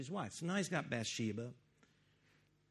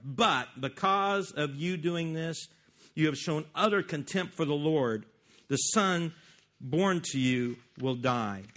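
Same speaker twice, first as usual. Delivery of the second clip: forceful proclamation; 7 dB louder than usual. Shown as a demonstration. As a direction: boom it out like a prophet. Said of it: "But he said".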